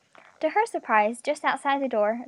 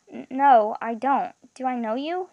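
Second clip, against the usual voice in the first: plain reading voice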